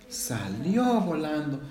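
ordinary delivery, nothing marked

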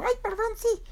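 very high squeaky apology; tiny chick voice, caught off guard; Berilio. Acted. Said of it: "Y Berilio dijo".

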